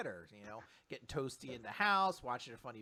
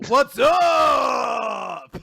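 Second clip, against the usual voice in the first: long drawn-out, higher-pitched holler; shouted catchphrase; 7 semitones higher, 15 dB louder than usual. Acted. Introducing a comedy duo character, drawn-out shouted catchphrase. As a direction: stretch it into a huge comic yell.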